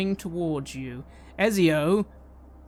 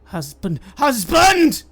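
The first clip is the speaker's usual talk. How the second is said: building to a high, very loud scream; monstrous rising cry of husband; raspy shriek; 7 semitones higher, 12 dB louder, slower than usual. Acted. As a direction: shriek as it charges. Construct charges.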